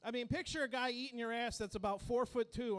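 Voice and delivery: ordinary delivery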